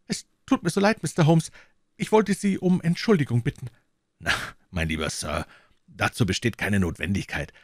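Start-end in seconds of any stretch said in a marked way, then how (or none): 0.1-3.7 high, eager apology after running; a young man catching his breath; breathless apologising assistant. A narrator's reading. Bennet apologising to Holmes after running, high and eager. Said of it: "Keuchend kam er auf uns zu".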